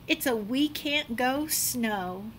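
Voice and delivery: usual speaking voice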